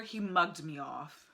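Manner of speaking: slow, put-on British lilt on mugged; lightly British "He mugged me off"; hint of a UK accent; British slang quote. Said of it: "We don't say that over here".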